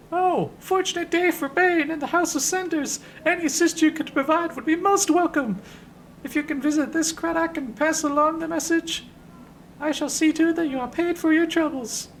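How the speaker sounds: delighted, loud high falsetto; Ben the Sender. Acted. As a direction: gush gratefully, way up high. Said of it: "face looks up like a lantern".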